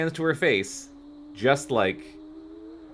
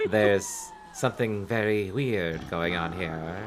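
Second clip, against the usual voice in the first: slow, low, softer purr; low sultry voice; 5 semitones lower, 4 dB quieter, slower than usual. Acted.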